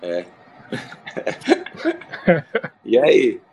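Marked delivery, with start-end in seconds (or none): 0.7-2.8 airy unvoiced laugh; high breathy laugh. Real, not acted. Laughs at the greeting.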